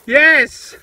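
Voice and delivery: a sudden loud, high cheer of victory; triumphant yes. Real, not acted. Detectorist cheering his winning find loud and high. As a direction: punch the air with it. Finds the coin he wanted.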